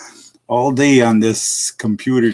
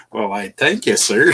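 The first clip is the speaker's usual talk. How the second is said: normal talking voice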